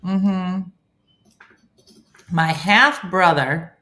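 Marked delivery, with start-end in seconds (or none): 2.3-3.8 loud, deliberate reading with rising end; slow puzzling-out. Real, not acted. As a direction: spell out the relation slowly. Slowly working through a confusing comment.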